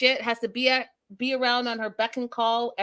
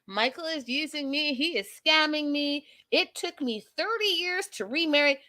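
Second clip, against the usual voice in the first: imitated high, softer whine of grievance; higher-voiced mocking retelling of a complaint; mock complaining; 5 semitones higher, 4 dB quieter than usual. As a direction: play her as the wronged wife, pitched up. Retelling Angela's complaint, acted.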